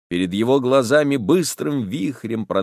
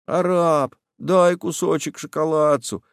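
high, sweet begging voice; wheedling tone; 6 semitones higher than usual. Acted. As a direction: wheedle for a treat like a boy. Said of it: "Тянет Федченко умильным тоном".

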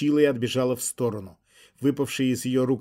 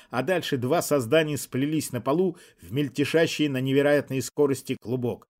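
plain reading voice

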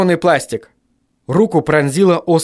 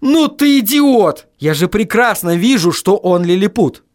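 raised-pitch angry retort; thundering; 6 semitones higher than usual. A narrator's reading. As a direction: thunder at an idiot on the phone. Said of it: "загремел я".

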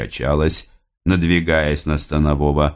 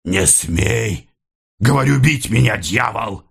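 angry warning, climbing higher; angry cry; 4 semitones higher than usual. A narrator's reading. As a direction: rise into a furious cry at the attacker. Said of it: "гневный крик Михаила". (гневный окрик)

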